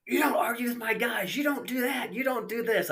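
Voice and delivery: high-pitched retold scolding; angry Earl. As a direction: imitate an angry track owner telling him off. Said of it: "Oh, shit, Earl's pissed".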